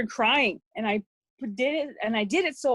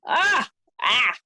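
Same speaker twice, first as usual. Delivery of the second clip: sharp frustrated "Ah!" twice, louder and higher; exasperated cry; 3 semitones higher, 7 dB louder than usual. Real, not acted. Mother venting to the host about her ex, exasperated cry. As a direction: let the frustration burst out in two short cries. Frustrated outburst after recounting abuse.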